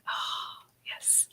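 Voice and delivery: breathy hushed aside; a quiet, knowing whisper. Real, not acted.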